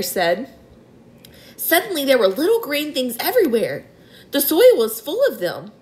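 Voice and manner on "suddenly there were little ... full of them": higher, different voice; Miss Spider. A narrator's reading. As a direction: recall it with wonder.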